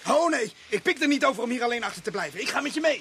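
high, urgent pleading; don't leave me here alone; frightened protest. Acted. Refusing to stay behind alone.

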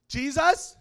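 high, shouted call to Jesus; child's loud prayer. Retold or imitated. Younger daughter starting her prayer, high shout. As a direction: holler it up like a showing-off little girl.

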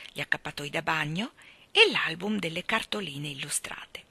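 ordinary delivery, nothing marked

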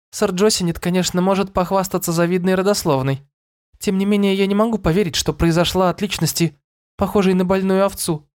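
put-on high, doubtful girlish voice; a girl unimpressed by her options; young princess voice. A narrator's reading. Madouc weighs her possible fathers. (голос юной принцессы)

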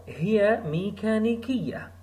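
هي ميكانيكية run together quickly; with some speed. Shown as a demonstration. Teacher showing the fast conversational way. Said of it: "You will give it some speed".

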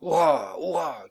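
loud high cheer; crew cheering. Acted. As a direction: shout it out in triumph. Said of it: "cria l'équipage".

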